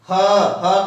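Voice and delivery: drawn-out "ह, ह का आधा" said slowly; slow demo. Shown as a demonstration. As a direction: stretch each word out to demonstrate. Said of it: "उससे आधा समय". (धीरे-धीरे खींचकर बोलें)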